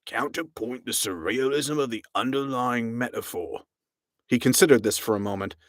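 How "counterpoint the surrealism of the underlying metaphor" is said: a hint of a pompous captain; musing captain. A narrator's reading. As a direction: muse it to yourself. Captain muses on poetry.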